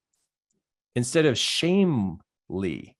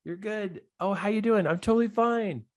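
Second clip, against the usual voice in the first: higher, breezy fake-fine voice; sing-song it like nothing's wrong; lightly mocking chirpy act; mock cheerful pretender; 6 semitones higher than usual. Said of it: "the world just wants everybody to go".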